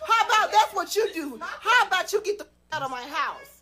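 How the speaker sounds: high, loud mock yelling at the squatter; retold angry yell. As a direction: bark it like you're kicking her out, half laughing. Retelling throwing tenant out.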